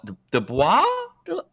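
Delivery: tentative, questioning rise on DeBlois; unsure pronunciation. Real, not acted. Host unsure of a director's name, questioning. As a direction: try DeBlois as a question, pitch climbing. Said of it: "I don't know how to pronounce".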